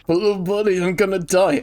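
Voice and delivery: plaintive little buddy. Acted. Acting out a dying little buddy.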